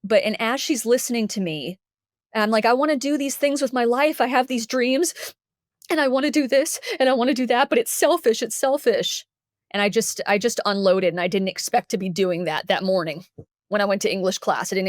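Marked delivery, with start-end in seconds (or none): 2.6-9.3 higher-pitched, upset pleading; retold tearful outpouring. Student unloading on counselor, retold tearful high voice. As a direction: imitate pouring it all out through tears.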